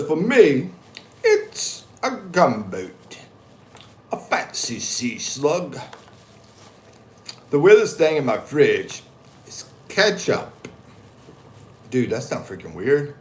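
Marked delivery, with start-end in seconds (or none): 0.0-10.7 reading a viewer's comment. Retold or imitated. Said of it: "Alexa says".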